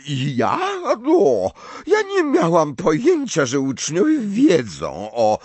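put-on high, slow voice of an eccentric old prophetess; Professor Trelawney. Trelawney caught off guard.

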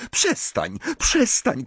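strained plea rising high; hysterical cry. A narrator's reading. Hermiona begs Ron to stop, crying out hysterically. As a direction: cry out to cut someone off. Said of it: "krzyknęła Hermiona".

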